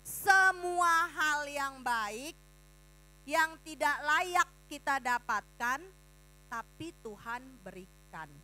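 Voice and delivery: put-on slow, every word drawn out for children to repeat; slow repeated definition. Shown as a demonstration. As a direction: say it slowly so they can follow. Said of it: "Lagi, apa kasih karunia?". (pelan-pelan, setiap kata ditarik panjang)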